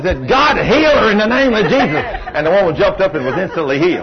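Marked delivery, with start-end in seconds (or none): 0.3-2.0 higher, forceful retold prayer; preacher's ringing plea for healing; commanding healing prayer.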